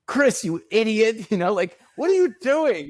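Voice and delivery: high, loud mock scolding; retold yelling. Actor chewing out his assistant Chris, imitated high, loud mock yell. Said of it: "he's just yelling, he's yelling at his, his assistant".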